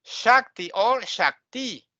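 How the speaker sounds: first Shakti front-stressed, second Shakti end-stressed; both accepted pronunciations. Shown as a demonstration. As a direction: say SHAK-ti or shak-TEE. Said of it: "You can pronounce both ways".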